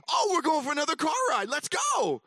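high, loud mock-eager dog; excited dog voice. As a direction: shout with a dog's eager excitement.

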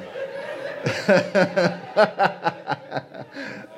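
long airy laugh, rising; delighted high chuckle with no voice; breathy high laugh. Real, not acted. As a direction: laugh freely at the moment. After crowd response.

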